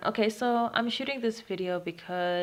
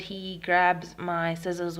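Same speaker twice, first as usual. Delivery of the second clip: usual speaking voice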